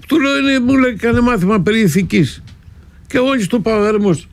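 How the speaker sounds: high-pitched, agitated retelling; worked up. Retold or imitated. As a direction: rattle it off high and exasperated. Agitated retelling of priest argument.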